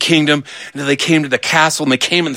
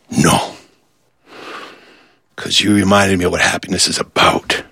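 tender sincerity; 7 semitones lower than usual. Acted. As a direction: drop low and speak from the heart. King gently stops the apology.

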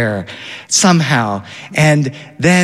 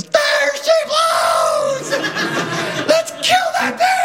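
imitating a kid shrieking in huge high glee; high laughing mock kid shout; son's wild shout; much slower than usual. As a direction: yell it out like a thrilled kid spotting a whale. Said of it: "And my son goes".

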